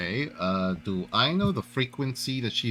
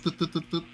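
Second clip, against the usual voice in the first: higher run of little toots; imitated radio toots; 6 semitones higher, faster than usual. Player miming the radio being switched on, imitated toots. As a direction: toot out a string of higher blips.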